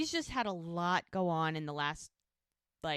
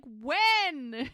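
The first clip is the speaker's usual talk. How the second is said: loud, high drawn-out yelp; retold panicky outburst, pitched way up; freaking out; 8 semitones higher, 7 dB louder than usual. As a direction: stretch it out in exasperated alarm. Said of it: "she's freaking out".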